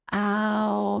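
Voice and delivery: ordinary talk, nothing marked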